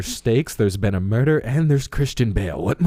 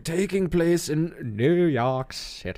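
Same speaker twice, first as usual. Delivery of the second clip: high, slow, mock period narrator; old-fashioned proper speech; 7 semitones higher, slower than usual. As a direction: ham it up like an 1800s orator. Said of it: "Do we have to talk like they do in the movie? All proper?".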